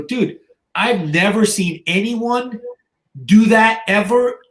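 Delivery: imitating a stunned admirer; retold amazement. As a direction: draw it out like an awed gym bro. Retold gym guys amazed at him.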